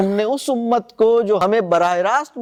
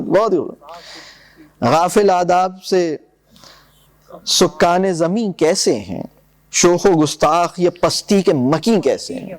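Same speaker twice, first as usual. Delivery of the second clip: slowed, lingering verse reading; relishing the couplet for students; slow savoured recitation; 3 semitones lower, slower than usual. Acted. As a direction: draw out each phrase as if tasting it.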